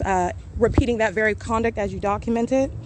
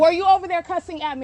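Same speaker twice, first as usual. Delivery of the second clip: angry accusation; 7 semitones higher than usual. Real, not acted. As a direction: demand an answer, fed up. Heated street confrontation.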